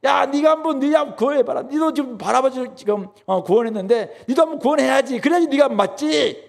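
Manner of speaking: high, taunting sneer; mocking jeer. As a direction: sneer up at him like a scornful ruler. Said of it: "지금 이렇게 비웃는 거예요".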